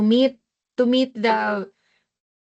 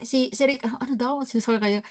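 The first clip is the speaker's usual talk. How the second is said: usual speaking voice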